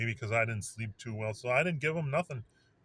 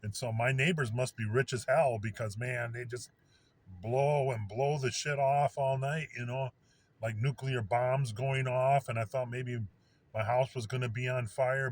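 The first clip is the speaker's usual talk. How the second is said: ordinary talk, nothing marked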